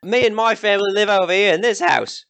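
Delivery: high, brash country villager; rough villager. Acted. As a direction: greet travellers as a nosy local. Villager Ian introduces himself.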